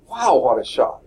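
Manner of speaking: dry mock surprise; deadpan sarcasm at the obvious vote; sarcastic wow. Real, not acted. Class votes take-home, as expected.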